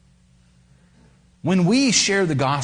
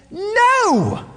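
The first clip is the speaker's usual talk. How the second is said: loud, very high, held no; emphatic no; 4 dB louder than usual. Acted. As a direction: ring out a final no. Emphatic answer.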